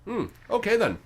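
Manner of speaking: high, loud, oddly cheerful creature; a chipper monster eager to bargain. Acted. GM's bone creature answers the party, high and chirpy.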